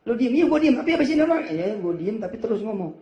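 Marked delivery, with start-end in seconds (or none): 0.1-1.4 loud, high mock protest; exasperated retort. Husband snapping back at his wife, loud and high. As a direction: throw it back at her, much louder.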